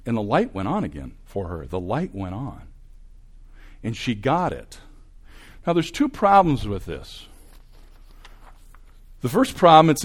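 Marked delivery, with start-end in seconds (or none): none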